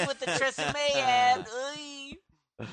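loud, high, drawn-out heckler caricature; wife's Comic-Con impression. Husband relaying his wife's impression of Comic-Con crowds, loud high caricature. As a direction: shout it high and grotesque, stretching the words.

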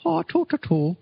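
high squeaky Irish voice, laughing; Irish accent. Shown as a demonstration. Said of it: "Mine was a".

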